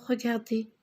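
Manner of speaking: ordinary talk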